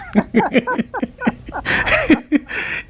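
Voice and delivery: a sudden high-pitched laugh; high burst of laughter. Real, not acted. Laughing at his own joke about family, a high laugh.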